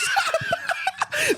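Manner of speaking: real very high-pitched laugh; shrieking laughter. Real, not acted. Storyteller hearing friend back him up, shrieking laughter. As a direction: burst into high, helpless laughter.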